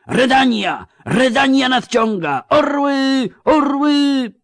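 very high, full-voiced war cries; battle roar. A narrator's reading. Old count hailing the Redanian eagles in battle, roaring. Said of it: "ryknął, wywijając buławą".

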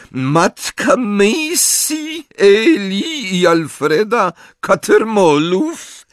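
high, slow, drawn-out questioning; velvety interrogator. A narrator's reading. Ambridge questions Mrs Cattermole, high velvety drawl.

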